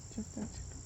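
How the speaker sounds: soft whisper to the hens. Real, not acted. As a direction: hush it so the birds stay calm. Hushing sleepy chickens.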